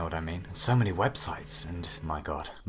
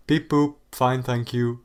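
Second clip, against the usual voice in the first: chirpy put-on robot beeps; fake robot voice; 5 semitones higher, 8 dB louder, slower than usual. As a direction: pretend to be a simple robot. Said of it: "very rehearsed computer noises".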